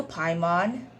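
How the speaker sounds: ordinary talk, nothing marked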